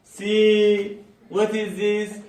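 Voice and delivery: showing off the sign. Real, not acted. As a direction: point it out with flair.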